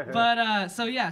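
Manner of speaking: ordinary delivery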